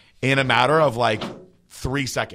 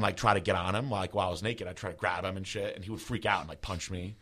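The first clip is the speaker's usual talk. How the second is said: ordinary delivery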